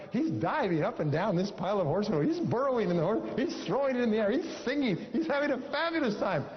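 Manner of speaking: storyteller rising to a gleeful peak; building excitement. A narrator's reading. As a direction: let the joy run away with you, rising on every line. Gleeful boy in manure pile.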